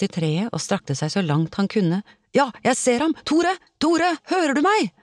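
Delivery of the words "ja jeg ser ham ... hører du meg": high, urgent call across distance. A narrator's reading. Olav spots Tore in the sea, urgent calling. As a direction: call out to someone far below.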